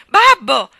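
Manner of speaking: desperate scream. A narrator's reading. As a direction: scream with all your strength. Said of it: "una voce di donna che urlava".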